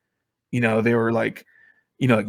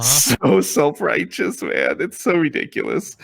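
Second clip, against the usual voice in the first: laughing scorn; 3 semitones higher than usual. Real, not acted. To cohost, mocking Barry's self-righteousness through laughter. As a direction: chuckle it out, amused at the hero.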